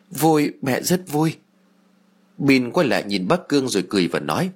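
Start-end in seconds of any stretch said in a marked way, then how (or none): 0.1-1.4 slow, choked and gentle; tearful mother. A narrator's reading. Mother reassuring her ghost son, slow and choked. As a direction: hold back tears as you say it.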